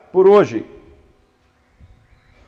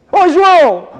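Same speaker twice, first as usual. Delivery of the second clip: loud, higher call of a name; God calling out; 10 semitones higher, 8 dB louder, slower than usual. Pretend. God calling a person by name, loud and high. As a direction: call him loudly across a distance. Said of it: "Deus chega pra gente e fala assim".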